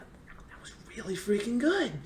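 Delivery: hushed, impressed whisper; awed whisper. Retold or imitated. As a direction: breathe the praise out quietly, amazed. Awed watching the drill again.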